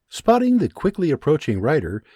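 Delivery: ordinary delivery, nothing marked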